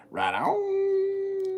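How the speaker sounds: high drawn-out right on; sing-song. Acted. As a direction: stretch the on up high and playful. Playful repeated catchphrase.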